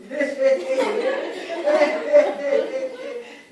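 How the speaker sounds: a wicked storyteller's cackle; loud evil cackle. Acted. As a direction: cackle with glee at the trap. Big laugh in the tale.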